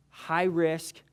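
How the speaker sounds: usual speaking voice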